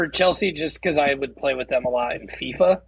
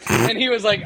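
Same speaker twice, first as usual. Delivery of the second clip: normal talking voice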